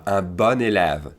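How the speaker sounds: "bon élève" slowly linked as "bonn-élève"; correct flowing liaison. Shown as a demonstration. Teacher showing what we wanna hear, slow linked liaison. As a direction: let "bon" flow into "élève" as one long word. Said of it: "that's what we wanna hear".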